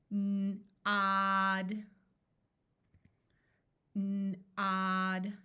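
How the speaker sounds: N-odd said slowly, the N held apart from odd; slow segmented word. Shown as a demonstration. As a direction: stretch N-odd into two slow parts. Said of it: "I will say the word slowly".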